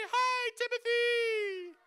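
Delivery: very high, loud and slow falsetto; little high voice. Shown as a demonstration. Coach showing the top of the voice, tiny falsetto. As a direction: squeak it up at the top of your voice. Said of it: "way up here in your little voice".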